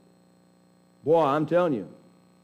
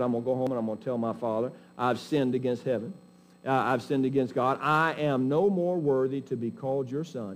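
soft, low confession of a penitent son; humbled, broken; 4 semitones lower, 4 dB quieter than usual. Acted. Prodigal son confessing to his father, humbled and quiet. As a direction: voice the contrite son quietly, without dramatics. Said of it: "That's a heart of brokenness".

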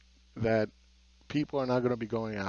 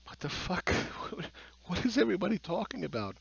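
incredulous whisper; 7 semitones higher, 3 dB quieter than usual. Retold or imitated. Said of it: "And I was like".

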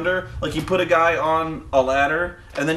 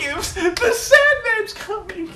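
very high, drawn-out gleeful squeak; mock squeal of childlike joy; Mike Tenay; slower than usual. Host mocks an announcer's delight at an entrance, squeaky happy impression. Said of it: "he was so happy".

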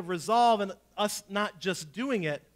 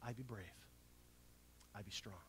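quiet; 11 semitones lower, 26 dB quieter, faster than usual. Acted. Quiet opening mantra of sermon.

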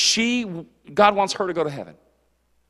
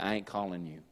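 low dismissal; 12 semitones lower, 16 dB quieter than usual. Pretend. Jesus dismissing self-righteous.

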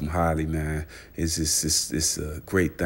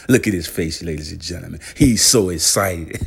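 excited hype; 8 dB louder than usual. Pretend. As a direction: call everyone's eyes to his face. Pointing at [name]'s reaction.

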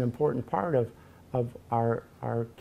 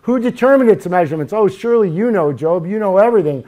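loud, higher-pitched mocking challenge; sarcastic; 4 semitones higher, 7 dB louder, faster than usual. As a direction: fire the questions off with loud sarcasm. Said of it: "I hear the sarcasm".

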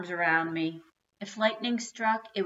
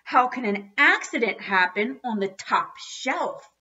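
loud, higher indignant question; howl; 5 semitones higher, 7 dB louder than usual. A narrator's reading. Dad disputing an accident on the top shelf, loud howling indignation. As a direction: hammer out the question in disbelief. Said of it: "howled my dad".

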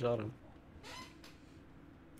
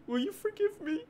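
tiny high, soft begging voice; hammed-up plea for forgiveness; mock pleading; 10 dB quieter than usual. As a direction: beg sweetly in a high, quiet squeak. Begging forgiveness after team kill.

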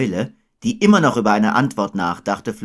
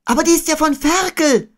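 very high, loud exclamation; crying out; 5 dB louder than usual. A narrator's reading. Boy reading Piglet's message, high loud cry. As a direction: exclaim at the top of a boy's voice. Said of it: "schrie Christopher Robin".

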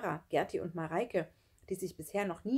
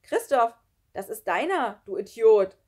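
loud, raised-pitch accusation; angry insult; 5 semitones higher, 11 dB louder than usual. A narrator's reading. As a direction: shout the blame at him. Boys quarrel over alarm clock. (laut und verärgert)

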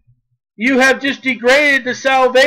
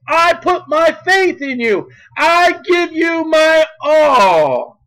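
raised, drawn-out declaration of faith; fervent preaching; 4 semitones higher than usual. Real, not acted. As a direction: proclaim it slowly and fervently to God. Preaching scripture at full force.

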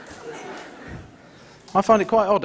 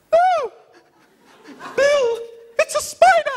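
shrill falsetto shriek of panic, pitched far higher; high squeal at the spider, louder; 4 dB louder than usual. Retold or imitated. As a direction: scream it like a terrified child. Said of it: "I go".

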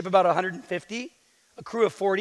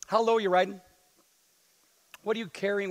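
normal talking voice

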